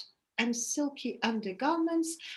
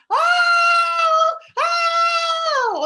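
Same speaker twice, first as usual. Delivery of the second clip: distant wail; 9 dB louder than usual. Retold or imitated. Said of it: "it sounded something like".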